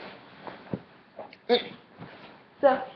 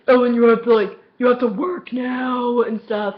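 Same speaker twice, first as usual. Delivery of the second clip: stern dad; 6 dB louder than usual. Retold or imitated. Acts out dad ordering her to work.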